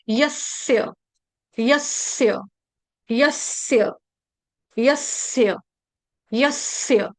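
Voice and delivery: यस्य as yas-sya with held s; correct pronunciation drill. Shown as a demonstration. Teacher repeating your word, held-s pronunciation. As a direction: linger on the doubled स of यस्य, then drop. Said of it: "आपका शब्द है".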